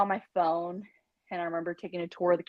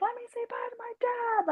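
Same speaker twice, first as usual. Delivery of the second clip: raging; 4 dB quieter than usual. Retold or imitated. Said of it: "I was, like, raging".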